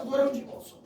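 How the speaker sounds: normal talking voice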